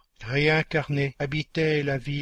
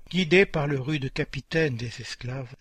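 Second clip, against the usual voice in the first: ordinary delivery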